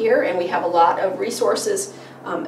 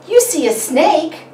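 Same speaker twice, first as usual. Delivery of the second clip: high, louder impressed question; amazed parent echo; 9 semitones higher, 5 dB louder than usual. Shown as a demonstration. Parent repeating a child's answer back, amazed. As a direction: echo the child with big wonder. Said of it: "And you would say".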